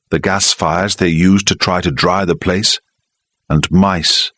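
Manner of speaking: normal talking voice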